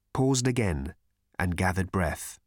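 usual speaking voice

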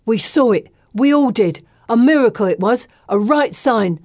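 loud, fervent exclaiming; passionate exclaiming. Acted. Bessie exclaiming to the girls with convert's passion. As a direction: proclaim the miracle with zeal. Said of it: "Bessie exclaims with the passion of the converted".

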